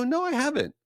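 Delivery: very high, expectant reply; eager answer. Retold or imitated. To the waiter, hoping for amazing news, very high and eager. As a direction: say it as if a big reveal is coming. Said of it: "I go".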